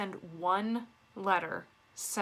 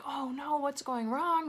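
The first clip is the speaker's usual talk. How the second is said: mildly panicked, higher-pitched reenactment; mock panic; 5 semitones higher, slower than usual. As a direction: act out a touch of alarm. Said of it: "I'm panicking. I'm like".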